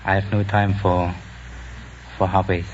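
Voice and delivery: usual speaking voice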